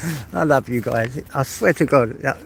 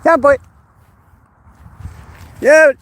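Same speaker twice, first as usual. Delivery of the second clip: short, loud, high-pitched shouts; calling out to dog; 10 semitones higher, 9 dB louder than usual. Real, not acted. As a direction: holler to your dog.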